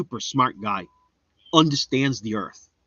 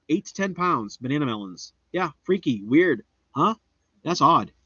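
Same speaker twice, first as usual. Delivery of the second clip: ordinary talk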